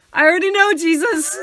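high, loud, hammed-up eager reply; mock eager answer. Mock eager reply to Jehovah's Witnesses at the door. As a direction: burst out with mock zeal.

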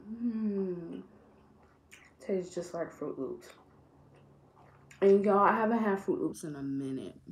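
talking around a gummy; chewing while talking. Real, not acted. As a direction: mouth partly full of candy. Seen 1: Gummy put in mouth at -4; jaw and lips keep chewing while talking.

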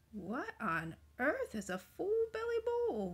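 slow, high-pitched bafflement; puzzled wondering. A narrator's reading. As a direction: draw the question out, rising high in wonder. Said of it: "wondered the very old man".

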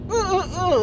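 put-on high protesting toddler grunts; jealous toddler. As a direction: imitate a jealous baby fussing. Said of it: "kinda jealous that he was there".

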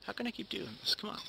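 ordinary delivery

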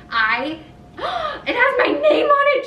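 gasp, then high loud squeal; slow, squealed disbelief and delight; thrilled astonishment. Real, not acted. Finding her name on a gifted cup, thrilled.

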